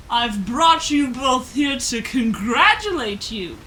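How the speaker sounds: slow, loud booming god voice with a laugh; a thunder god's booming greeting to the heroes. Acted. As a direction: proclaim it grandly, slow and loud. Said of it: "picture Zeus from Epic".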